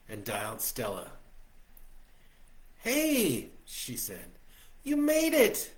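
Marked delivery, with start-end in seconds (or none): 2.8-5.7 higher, brighter woman's voice; Stella's voice. A narrator's reading. Stella greeting him on the phone, much higher. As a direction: lift into a happy woman on the phone.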